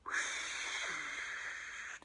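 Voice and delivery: long, soft whooshing gust; blowing wind. Shown as a demonstration. Storyteller shows children the blowing, breathy whoosh. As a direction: blow out a sustained windy whoosh. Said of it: "can you do the blowing".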